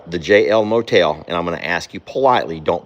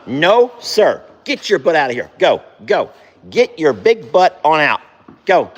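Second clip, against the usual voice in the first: firm higher scold; scolding horse; 6 semitones higher, 3 dB louder than usual. Real, not acted. Owner shooing horse out of stall, firm and higher. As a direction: shoo the big horse out, mock stern.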